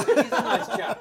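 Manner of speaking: lightly voiced, slow and laughing; mock sweet-voiced echo; mock naive listener. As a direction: laugh through a naive fan's verdict. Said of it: "Very simple sounding fellow".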